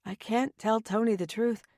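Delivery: usual speaking voice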